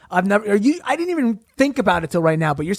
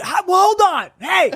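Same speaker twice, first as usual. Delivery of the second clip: mock heckler; 12 semitones higher, 3 dB louder than usual. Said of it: "these people who are like".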